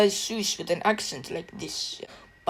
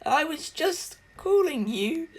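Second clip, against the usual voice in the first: higher, slow, laughing sheepish excuse; caught out; 7 semitones higher, slower than usual. Retold or imitated. Thor caught by Stormbreaker, a sheepish, slow, higher excuse. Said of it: "he's going".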